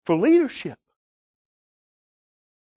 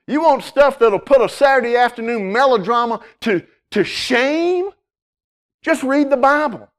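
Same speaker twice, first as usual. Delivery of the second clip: higher, louder, revival-style build; fiery preaching; 6 semitones higher, 4 dB louder than usual. Acted. Pastor urging listeners to read the Bible, fiery preaching. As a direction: sell the Bible like the best drama in town.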